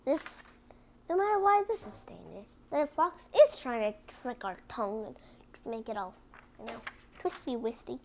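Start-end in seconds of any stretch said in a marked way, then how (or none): none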